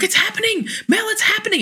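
high frantic alarm; panicked call. Retold or imitated. Calling Mel mid-panic, high and frantic. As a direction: panic down the phone. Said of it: "I'm like".

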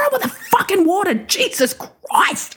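high exasperated screech, last words stretched out; retold furious outburst. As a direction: act out furious disbelief at being woken. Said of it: "I'm furious".

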